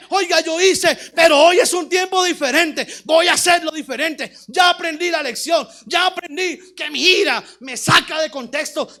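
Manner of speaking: loud, driving sermon delivery; preaching at full force. Real, not acted. Preacher rebuking the paralytic's passivity, full-force preaching. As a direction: hammer the key words, preacher-style.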